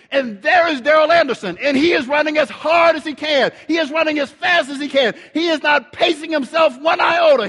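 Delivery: high, loud, urgent play-by-play; excited race call. Retold or imitated. Telling the congregation of Darrell's comeback, excited and shouting. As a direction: call it like a frantic trackside commentator.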